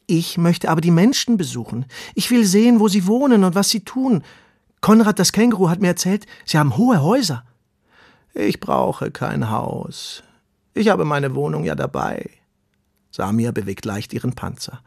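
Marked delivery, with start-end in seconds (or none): 0.1-7.4 higher, louder, excited worm voice; eager little earthworm, longing. Acted. Earthworm Ronny tells the tortoise he wants to visit people. 8.3-12.3 unhurried and content; calm old tortoise. Acted. Tortoise tells the worm she carries her home.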